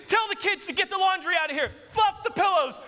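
high, urgent imitation of a bossy wife; frantic orders. Wife orders a cleanup before visitor arrives, frantic. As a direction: fire off orders in mock panic.

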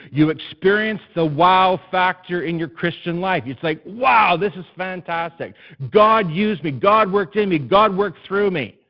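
loud, high, driving sermon voice; full-force preaching. Acted. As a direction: preach it, building to the Lord's questions.

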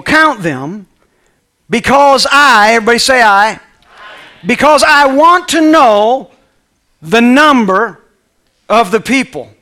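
slow, loud, high royal order; king's command. Acted. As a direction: drag out the king's demand. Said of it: "Let me say it again".

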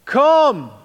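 loud, high, sharp call; father calling his sons a second time; dad calling again. Retold or imitated. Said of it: "He would try again".

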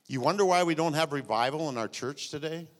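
ordinary talk, nothing marked

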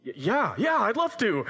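put-on high, eager kid's yes; bouncy, high-pitched eagerness; excited child. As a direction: imitate a boy bursting to help. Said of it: "Gets all excited".

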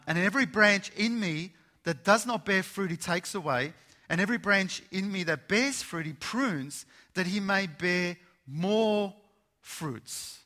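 loud preacher's reading; preaching scripture. Acted. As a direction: read the verse out with force. Reads scripture forcefully.

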